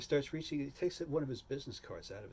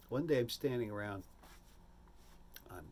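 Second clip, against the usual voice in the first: normal talking voice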